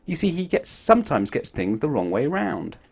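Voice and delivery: ordinary delivery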